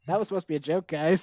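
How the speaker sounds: ordinary talk, nothing marked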